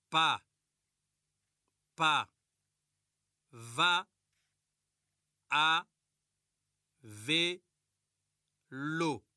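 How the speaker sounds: each syllable said alone with a long pause after it. Shown as a demonstration. Teacher showing the class how to split words into syllables, one syllable at a time. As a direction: chop the words into slow separate syllables, a clap on each. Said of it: "en séparant les syllabes comme ceci".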